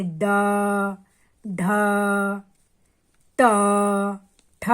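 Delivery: ड, ढ, ट, ठ each stretched, with long pauses between; relaxed, drawn-out ड, ढ, ट, ठ; slow, unhurried sounds. Shown as a demonstration. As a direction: set down ड, ढ, ट, ठ gently, one by one. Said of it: "सबको हमने आराम आराम से बोला".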